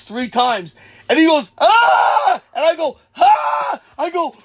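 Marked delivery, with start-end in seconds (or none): 1.6-2.4 loud high mock scream; retold shriek of shock; panicked scream. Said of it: "he's freaking out". 3.2-3.8 high, loud answering yelp; mock scream right back at him; retold yelp of alarm; panicked scream. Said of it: "I'm freaking out".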